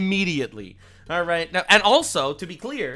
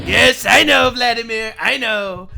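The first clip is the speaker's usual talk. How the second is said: louder, slowed boast ending; boastful Trump; 2 semitones higher, 7 dB louder, slower than usual. Retold or imitated. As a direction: gloat like a smug president. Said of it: "And he's like".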